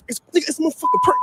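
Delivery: high, fast exasperated burst; prank reveal. Pretend. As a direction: blurt out that it's just a prank.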